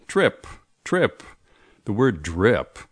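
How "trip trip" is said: "trip" said high with an aspirated t; teacher confirming the answer "trip"; emphatic answer. Shown as a demonstration. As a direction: punch the t of "trip". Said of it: "The answer is B".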